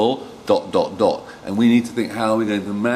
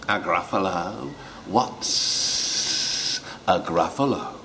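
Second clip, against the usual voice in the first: 1 hissing snake; 2 semitones lower, 5 dB quieter, slower than usual. Acted.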